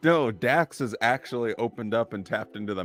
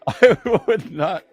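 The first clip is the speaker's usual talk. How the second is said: laughing refusal; 9 semitones higher, 3 dB louder than usual. Pretend. Host teasingly refuses the German accent, laughing.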